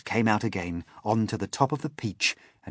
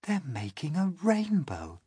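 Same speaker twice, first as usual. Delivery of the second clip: awed realisation; 9 semitones higher than usual. A narrator's reading. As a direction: marvel at the discovery. Everyone sees the rainbow.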